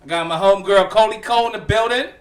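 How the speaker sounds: big up the crew like an announcer; hype crew shout-out. Acted. Host introduces homegirl and homeboy, hyped announcer style.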